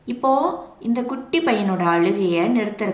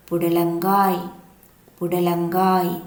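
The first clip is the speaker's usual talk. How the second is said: புடலங்காய் said slowly and clearly, twice; slow clear naming; 2 semitones lower, slower than usual. Shown as a demonstration. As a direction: spell out புடலங்காய் slowly for the kids. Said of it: "இது என்ன காய்னு தெரியுதா?". (புடலங்காய் மெதுவாக தெளிவாக)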